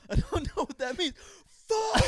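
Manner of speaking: high mock outburst ending in a scream; baffled whine exploding into a yell; mad at not understanding. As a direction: imitate helpless rage at the universe. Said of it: "gets mad at not understanding".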